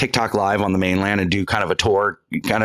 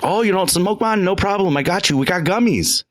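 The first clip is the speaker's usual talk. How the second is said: a light mock Jamaican accent, pitched higher; playing the kayak vendor in a rough Jamaican accent; imitating Jamaican accent; 8 semitones higher than usual. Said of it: "You sound like an Indian".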